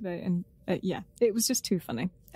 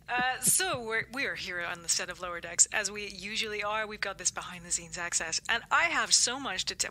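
put-on a light American reporter accent; 3 dB quieter than usual. The co-host answers the anchor in a light American accent. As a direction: slip into a mild American field-reporter voice. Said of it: "why am I gone American?".

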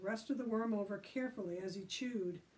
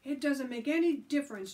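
raised, loud arguing voice; yelling; 6 semitones higher, 7 dB louder than usual. A narrator's reading. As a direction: yell it at your opponent. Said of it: "Joe yelled at Billy".

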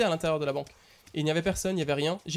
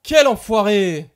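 slow, loud furious yell; startled angry shout; 2 semitones higher, 11 dB louder, much slower than usual. Real, not acted. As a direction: shout it out in shock. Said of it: "il m'a fait peur".

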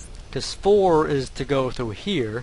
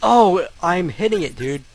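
loud, higher, exasperated insistence; frustrated protest; 4 semitones higher, 6 dB louder than usual. Real, not acted. Gamer insisting the button was hit, frustrated. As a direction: argue with the unfair game. Insists inputs are right.

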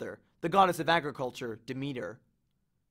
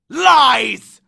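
one huge, much louder and higher blast; furious roar; 9 semitones higher, 16 dB louder than usual. Acted. Hades roars denial at Percy, furious. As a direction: spit the accusation back.

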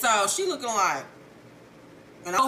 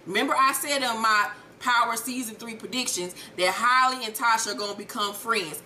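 usual speaking voice

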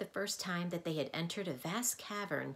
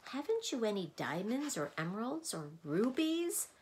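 irritable; 6 semitones higher than usual. A narrator's reading. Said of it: "asked the bug irritably".

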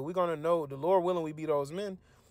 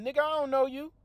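high, casual brush-off; the Lord dismissing the unfaithful, lightly voiced; mock Lord's rebuff; 9 semitones higher than usual. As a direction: wave them off like a stranger. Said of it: "the Lord basically saying".

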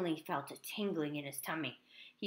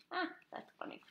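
breathy chuckle; 10 semitones higher, 10 dB quieter, faster than usual. Real, not acted. Reader laughs at dedication.

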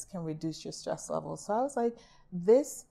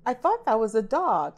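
louder, higher feigned confusion; mock puzzled critic; 4 semitones higher, 8 dB louder than usual. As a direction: question the artwork like a tactless critic. Critic questioning a child's drawing.

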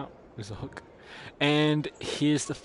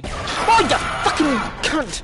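real panicked scream, much higher and louder; terrified scream; 14 semitones higher, 10 dB louder, slower than usual. Real, not acted. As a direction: scream it, high and loud. Another jump scare.

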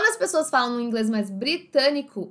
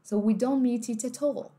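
British T's; 3 dB quieter than usual. Shown as a demonstration. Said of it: "Todos os Tzinhos deles são marcados".

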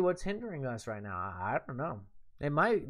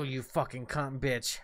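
ordinary talk, nothing marked

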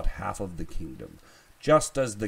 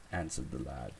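ordinary delivery, nothing marked

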